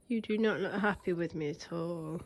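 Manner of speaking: usual speaking voice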